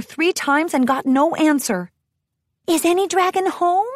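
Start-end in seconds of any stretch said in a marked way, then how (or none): 2.7-3.9 high, timid little pony voice; a shy, high-pitched question; meek and high, afraid to be heard; timid Fluttershy. A narrator's reading. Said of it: "Fluttershy whispered, her voice barely audible".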